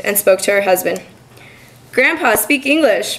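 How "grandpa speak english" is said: higher, drawn-out call with little added volume; mild narrated shout. A narrator's reading. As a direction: call out to grandpa, slow and raised in pitch. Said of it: "Mari shouted at her grandfather".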